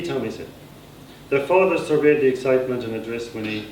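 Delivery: ordinary delivery, nothing marked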